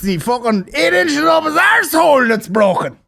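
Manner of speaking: hammed-up Irish accent; Christopher Walken gone Irish. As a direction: rant in exaggerated stage Irish. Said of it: "Christopher Walken in Pulp Fiction, but Irish".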